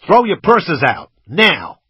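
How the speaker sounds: put-on loud, high, slow American-accented command; shouted order. A narrator's reading. Gunman shouts at girls in the back, loud order. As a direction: bellow it slowly to the back seat. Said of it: "I shouted into the back".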